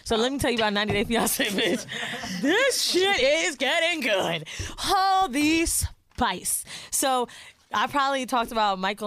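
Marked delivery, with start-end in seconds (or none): 2.4-6.7 slow, higher, drawn-out put-on voice; put-on hype voice. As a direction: stretch each word out in a funny voice. Gossip hype opener.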